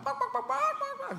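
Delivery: high barked words like a small dog; yappy dog barking. Pretend. Barking like a dog to answer the man. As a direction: bark back like a dog.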